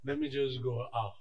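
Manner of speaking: low, soft, slow sigh of love; low soft relieved voice; calmed tenderness. Retold or imitated. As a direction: melt into calm. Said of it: "And I feel like".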